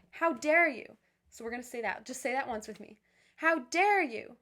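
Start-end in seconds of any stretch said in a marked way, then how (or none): 0.1-1.0 loud, higher indignant demo; really mad. Shown as a demonstration. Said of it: "Like you're really mad". 3.4-4.4 loud, slow, higher outraged demo; really angry. Shown as a demonstration. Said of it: "Really angry".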